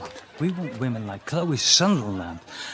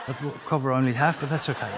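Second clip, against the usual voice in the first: normal talking voice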